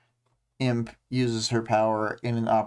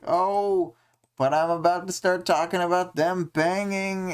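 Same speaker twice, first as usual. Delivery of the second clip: higher, teasing put-on voice for Imp; cheeky Imp voice; 7 semitones higher than usual. Host voicing Imp teasing the couple, higher and cheeky. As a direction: tease like you're about to say something rude. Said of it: "being like".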